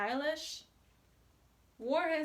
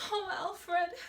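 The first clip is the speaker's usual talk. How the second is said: pleading; 7 semitones higher, 4 dB louder than usual. A narrator's reading. Dancer pleading with Alfred.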